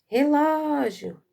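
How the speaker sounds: high, drawn-out call with a long LÓ in Relógio. Shown as a demonstration. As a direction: call Relógio as if it were outside. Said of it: "se nós chamarmos como nós chamamos".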